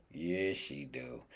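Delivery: soft, low, drawn-out yes; low, slow answer. Pretend. Cook admiring his duck to himself, low slow answer. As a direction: drop low and stretch it slowly.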